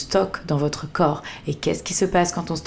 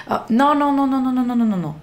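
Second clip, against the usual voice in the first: mock denial; 7 semitones higher, 3 dB louder, faster than usual. Mock inner voice refusing anger.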